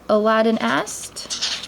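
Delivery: plain reading voice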